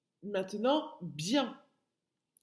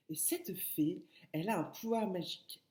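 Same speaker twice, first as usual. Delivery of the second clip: storyteller whisper; 4 dB quieter than usual. Acted. Teacher telling of the fairy.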